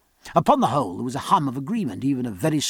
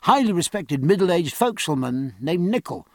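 plain reading voice

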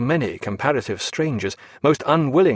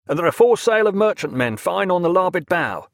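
higher and keen, news tumbling out; an excited young mate sighting prey; eager cry; 6 semitones higher than usual. Acted. Mate reporting merchant sails to the captain, eager cry. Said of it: "he cried".

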